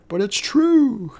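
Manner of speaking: hammed-up drunk husband pleading; high whiny plea. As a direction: whine it like a caught child.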